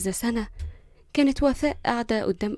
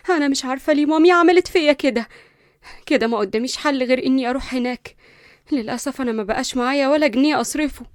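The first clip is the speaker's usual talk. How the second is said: higher and louder, upset; nervous anger; 4 semitones higher, 6 dB louder than usual. Acted. Linda upset at her late mother's will, loud and frustrated. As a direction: vent the frustration. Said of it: "من كتر العصبية".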